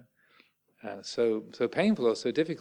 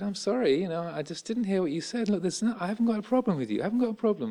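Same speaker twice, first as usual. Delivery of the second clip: reassuring; 8 semitones higher, faster than usual. Retold or imitated. Calming an aggressive stranger.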